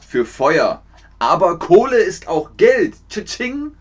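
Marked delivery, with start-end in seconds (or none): 3.1-3.7 high, soft ka-ching; little high-pitched money sound; cash register noise. Shown as a demonstration. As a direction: imitate a till ringing up money.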